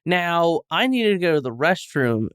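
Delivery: ordinary delivery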